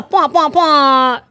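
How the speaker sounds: loud mouthed horn blasts; drawn-out, slow honking noise; air horn imitation. Shown as a demonstration. Imitating a horn sound effect.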